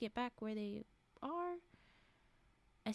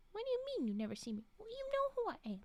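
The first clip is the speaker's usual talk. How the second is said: pitched way up, disbelieving; high incredulous quote; 9 semitones higher, faster than usual. Retold or imitated. Jen insisting the doctor surely knows her, high and incredulous. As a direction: squeak it out like she can't believe it. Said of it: "That's how she said it".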